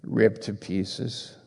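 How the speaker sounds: quiet, slow sermon voice, letting each phrase sink in; hushed reverent preaching. Acted. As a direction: speak low and slow, like a solemn confession. Slow hushed opening about the Savior.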